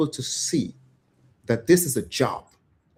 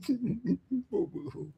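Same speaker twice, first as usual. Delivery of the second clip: mock sobbing; 9 semitones higher, 10 dB quieter, much slower than usual. Mock crying after lament.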